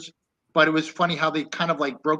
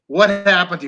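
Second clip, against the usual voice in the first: louder, higher emphatic repeat; emphatic retold quote; 4 semitones higher, 9 dB louder than usual. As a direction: punch the question harder.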